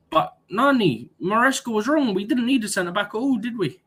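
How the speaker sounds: high-pitched mock protest; sarcastic. Football fan needling co-host about needing a centre-back, sarcastic. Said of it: "I'm being sarcastic".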